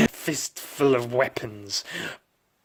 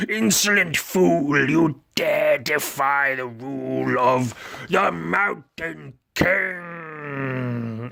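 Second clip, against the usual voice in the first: higher, slow, grand evil-king proclamation; villain king; 3 semitones higher, slower than usual. Acted. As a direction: sneer down at the insolent hero. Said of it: "says the mysterious skull guy".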